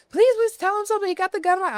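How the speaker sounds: high, urgent retold plea; frantic begging. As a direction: plead frantically, acting out the call for help. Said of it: "I'm, like, fucking begging her".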